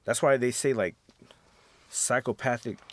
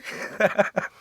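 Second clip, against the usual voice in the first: breathy laugh; 11 semitones higher, 5 dB louder than usual. Real, not acted. Laughing at retold scene.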